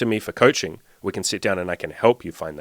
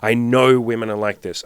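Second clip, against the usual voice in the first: loud certainty; 3 semitones higher, 6 dB louder than usual. Retold or imitated. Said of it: "and I said".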